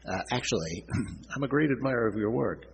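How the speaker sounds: nasal nerdy professor voice, throat clearing; squeaky awkward professor, clearing his throat; Jerry Lewis Nutty Professor voice. A narrator's reading. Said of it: "supposed to do a Jerry Lewis Nutty Professor voice".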